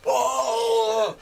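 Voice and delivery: high, loud imitated oh; long hooting exclamation; mock outburst. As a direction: burst out before laughing. Prisoner bursts out at the answer.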